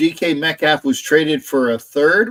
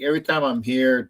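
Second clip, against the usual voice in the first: ordinary talk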